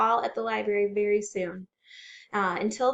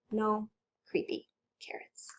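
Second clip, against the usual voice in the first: hushed unvoiced whisper; 8 dB quieter than usual. A narrator's reading. Reading to kids, whispering the last search. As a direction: let the refrain fade to a hush.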